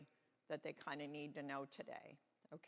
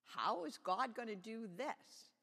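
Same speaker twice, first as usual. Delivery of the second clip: wondering thought; 5 semitones higher, 3 dB louder than usual. A narrator's reading. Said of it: "thought Moses".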